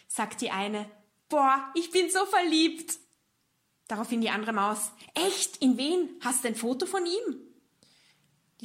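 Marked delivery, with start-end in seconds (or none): 1.3-3.0 high, slow, dreamy little mouse voice; lovestruck mouse. Acted. 5.1-7.4 high and bright with curiosity, a nosy little mouse; eager excited mouse. Acted. Second mouse eagerly asks.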